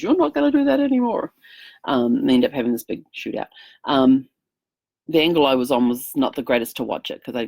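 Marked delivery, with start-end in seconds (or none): 0.0-1.3 put-on young hero challenge. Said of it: "this young guy comes in and says".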